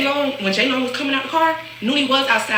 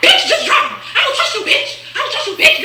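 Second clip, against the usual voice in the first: much higher, laughing squeal of refusal; laughing mock shriek. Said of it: "Nooney like".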